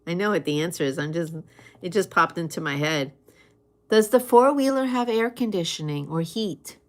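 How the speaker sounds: usual speaking voice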